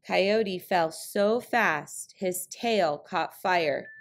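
usual speaking voice

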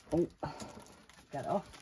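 usual speaking voice

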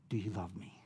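a breathy, intimate whisper; Jesus asks Peter a third time, whispering; whispered question. Retold or imitated. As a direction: whisper it as if only to him.

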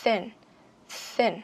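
"Thin" with tongue between the teeth and a puff of air; th with breath pushed out. Shown as a demonstration. Teacher to class: tongue between teeth, breath pushed out on "Thin". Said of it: "Đẩy hơi ra".

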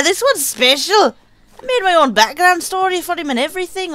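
normal talking voice

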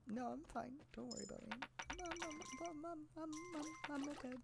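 tiny high voice, pretending to cry; mock-tearful, soft high stammer; fake tearing up. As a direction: squeak out a put-on brave "I'm fine". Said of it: "I'm not actually tearing up right now".

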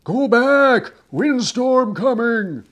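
high, loud shout across the wind; shouted warning. A narrator's reading. Sailors warn Nick back from the sea, shouting. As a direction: yell it to be heard over a storm. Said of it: "shouted to him through the whipping wind".

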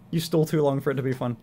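ordinary talk

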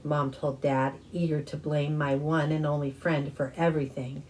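usual speaking voice

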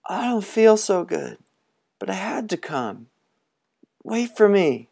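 put-on a sick boy straining to be heard; sick little boy. Pipkin calls, unwell.

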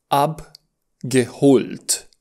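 Abgeholt stretched syllable by syllable; very slow model word. Shown as a demonstration. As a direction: draw out ab-ge-holt. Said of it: "Hast du".

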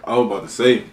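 ordinary talk

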